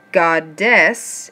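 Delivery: "Goddess" pulled apart slowly as GOD-DESS; syllable by syllable. Shown as a demonstration. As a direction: sound out "Goddess" in two separate beats. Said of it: "break down the syllables".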